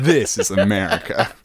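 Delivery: laughing. Real, not acted. Echoing joke while laughing.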